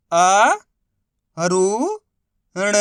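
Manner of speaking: "अ", "रु", "ण" one at a time, drawn out; अक्षर-दर-अक्षर उच्चारण. Shown as a demonstration. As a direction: sound out "अ", "रु", "ण" with clear gaps between. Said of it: "एक अक्षर का उच्चारण सही से करना है". ("अ", "रु", "ण" को अलग-अलग खींचकर बोलना)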